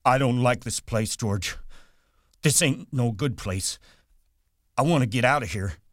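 Lenny pleads with George to leave; crying out. A narrator's reading. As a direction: blurt it out, scared of this place. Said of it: "Lenny cried out suddenly".

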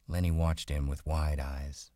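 ordinary delivery, nothing marked

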